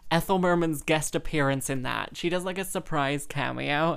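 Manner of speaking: normal talking voice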